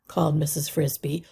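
ordinary delivery

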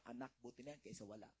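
tender fatherly reassurance; gentle father. Retold or imitated. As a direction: soothe the child softly. Loving father consoles child.

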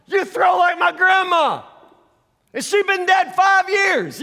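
high, loud heckle, slowing at the end; cocky kid mocking a thrower from the dunk booth; shouted taunt. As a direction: razz the thrower with a cocky yell. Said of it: "like, yell stuff".

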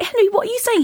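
high, disbelieving protest; high-pitched mock dismay; retold incredulity. Retold shocked reply to proposal.

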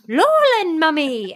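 put-on high toddler call; toddler pointing out. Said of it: "he goes".

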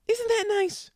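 sudden very high, squeaky sarcastic voice; squeaky mock sweetness. Mock sweet after angry intro.

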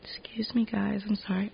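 hoarse whisper after coughing. Real, not acted.

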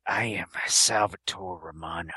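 whispered mock Lambert as the Sicilian; Sicilian character impression. Mocking Lambert's Sicilian role, whispered. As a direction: breathe the name like a brooding movie hero. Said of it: "Christopher Lambert as, uh, the Sicilian".

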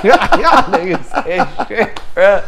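high, loud, drawn out through laughter; laughing exclamation. Retold or imitated. Retelling his reaction to the taunt, laughing. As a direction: groan it out while cracking up.

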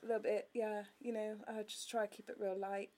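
put-on soft, light British accent kept up. Still in a light British accent with friends. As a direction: keep it airy and British. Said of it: "a British accent".

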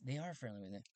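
ordinary talk

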